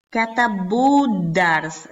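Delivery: "Katabuddars" spoken slowly, syllable by syllable; slow model word. Shown as a demonstration. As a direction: lay out "Katabuddars" slowly for the class. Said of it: "para que viésemos cómo se pronuncia".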